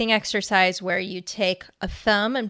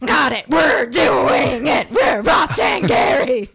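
loud, slow, overeager shout; eager shout; 6 semitones higher, 5 dB louder, slower than usual. Retold or imitated. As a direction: proclaim it too hard. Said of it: "So we were both like".

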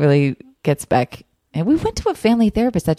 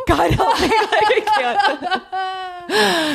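high, laughing dog voice pleading for mercy; a giggly put-upon dog; voicing the overwhelmed dog; 13 semitones higher, much slower than usual. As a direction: play the smothered pet begging to be spared. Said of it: "who was probably like".

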